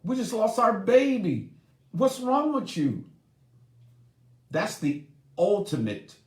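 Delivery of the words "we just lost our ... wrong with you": higher, pleading grieving-mother voice. Retold or imitated. Mother to distant husband, high grieving plea. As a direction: plead with him in grief. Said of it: "She's wondering".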